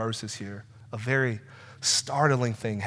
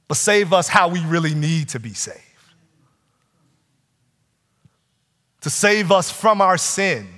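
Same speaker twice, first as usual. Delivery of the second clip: loud, higher preaching swell; preaching at full force; 7 semitones higher, 8 dB louder than usual. Acted. Preacher to the congregation about salvation, full-force preaching. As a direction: build it like a sermon climax over music. Climactic preaching over music.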